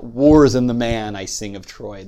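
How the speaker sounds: "wars and the man I sing of Troy", "wars" struck loud; dactylic cadence. Shown as a demonstration. Lecturer proving the translation keeps the meter, dactylic cadence. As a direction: hit "wars" hard, then roll on in threes. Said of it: "there's a dactylic cadence".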